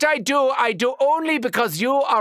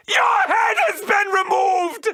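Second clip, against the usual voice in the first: gleeful laughing shout; 9 semitones higher than usual. Acted. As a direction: crow with delight and cackle. Gleeful doctor after a kill.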